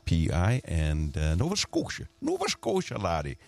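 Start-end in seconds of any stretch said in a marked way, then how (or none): 1.4-3.4 badly faked Scottish lilt, jumping up high; terrible mock Scottish accent. Radio host clowning about the Maritimes trip, bad Scottish accent. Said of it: "That was a terrible accent".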